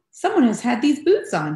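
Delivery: huffy Mama Bear. A narrator's reading. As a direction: huff it out indignantly. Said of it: "she huffed".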